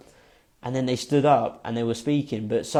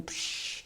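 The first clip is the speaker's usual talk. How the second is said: soft voiceless rushing "psh"; imitated whoosh; 14 dB quieter than usual. Storyteller back on the roller coaster, imitated whoosh. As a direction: blow out a long hushed whoosh.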